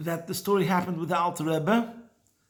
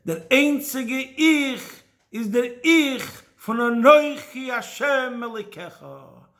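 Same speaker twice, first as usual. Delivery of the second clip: slow, raised, measured teaching voice; solemn pronouncement; 4 semitones higher, 4 dB louder, slower than usual. Acted. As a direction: deliver the lesson like a master. Maggid's climactic teaching.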